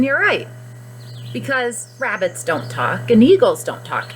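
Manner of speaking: normal talking voice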